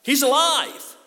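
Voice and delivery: preacher shouting the good news; triumphant shout. Acted. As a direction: shout it to the rafters. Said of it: "Say that with me".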